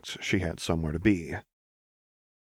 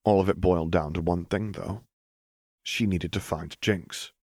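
usual speaking voice

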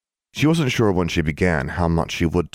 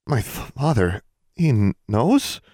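stammering, halting shock; slowly, catching on the word knows; a girl stunned by betrayal, stuttering; stunned disbelief; 6 semitones higher, slower than usual. A narrator's reading. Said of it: "eyes widened in disbelief".

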